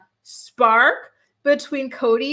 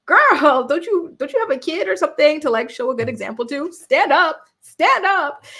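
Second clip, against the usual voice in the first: higher, louder exasperated plea to the screen; urging her to stand up, fed up; exasperated scolding; 5 semitones higher, 5 dB louder than usual. Real, not acted. Viewer yelling at TV character, exasperated.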